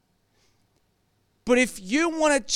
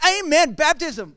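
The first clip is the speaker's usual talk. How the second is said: higher, louder eager exclamation; mock excited amen; 5 semitones higher, 4 dB louder than usual. Pastor mimicking his own eager reaction, excited. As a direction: cheer it like a thrilled believer.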